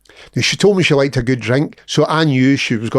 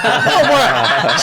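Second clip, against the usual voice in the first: much higher, laughing protest; outraged; 8 semitones higher than usual. Retold or imitated. To cellmate hiding the private letter, high laughing protest. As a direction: squeal it, cheated and amused. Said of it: "I was like".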